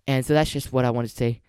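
ordinary talk

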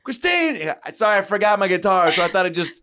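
lightly voiced embarrassed backup singer; sheepish girl voice. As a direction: meekly own up to the forgotten guitar. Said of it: "They go".

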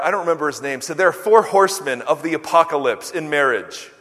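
ordinary talk

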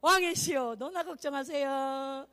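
slow, stretched courtly address; mock-polite. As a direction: draw it out with playful false courtesy. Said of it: "좋게 하는 거야". (느리게 늘여 짐짓 공손하게)

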